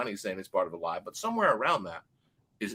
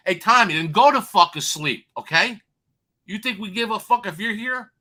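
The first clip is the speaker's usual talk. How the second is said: telling him off; 8 semitones higher, 6 dB louder than usual. Real, not acted. Host to interrupting co-host, a loud telling-off. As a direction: yell him down mid-story.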